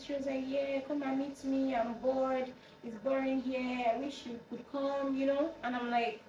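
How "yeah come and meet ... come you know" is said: higher, drawn-out mimicry of a bored friend; higher put-on caller voice; bored friend coaxing. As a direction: imitate a friend coaxing down the phone. Friend pleading from Ghana in retold call.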